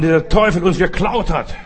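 normal talking voice

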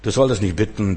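ordinary delivery

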